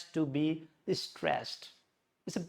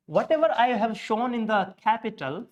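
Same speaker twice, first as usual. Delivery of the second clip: ordinary delivery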